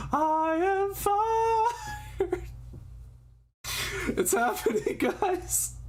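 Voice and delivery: hammed-up hype. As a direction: belt it like a transformation scream. Taka transforming, hyped reaction.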